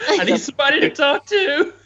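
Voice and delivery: squeaky high voice breaking into laughter; laughing. Real, not acted. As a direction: laugh through the line. Joking about working alone.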